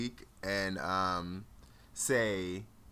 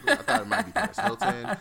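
laughing; 11 semitones higher, 6 dB louder than usual. Real, not acted. Laughing at own confusion.

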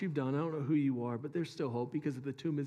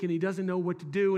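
ordinary talk

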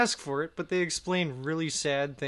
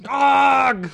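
high, loud bark of a yell; loud shout; 10 semitones higher, 13 dB louder than usual. Pretend. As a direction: yell it out suddenly. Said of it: "you're like".

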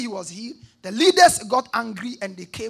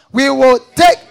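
louder, ringing congregation reading; 3 semitones higher, 16 dB louder than usual. Shown as a demonstration. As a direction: call it out loud together. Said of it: "Read it loud".

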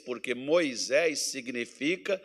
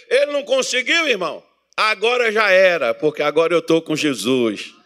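louder, rhythmic call and response; full-force preaching; 8 semitones higher, 7 dB louder than usual. Acted. Preacher pressing the congregation with irmão, loudly. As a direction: drive each question home to the church.

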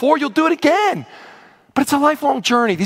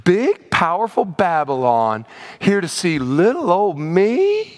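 flattered Hezekiah; 3 semitones lower, slower than usual. Retold or imitated. Pastor plays Hezekiah greeting envoys, fawning and flattered. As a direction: gush like a king who can't believe he's noticed. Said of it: "dripping with flattery, with insecurity".

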